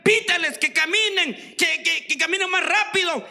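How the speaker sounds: preacher retelling an impatient order to his driver. Retold or imitated. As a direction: bark at the driver to hurry. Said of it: "le decía al chofer".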